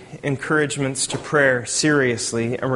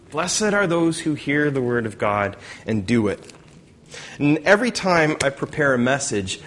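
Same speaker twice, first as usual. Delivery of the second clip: usual speaking voice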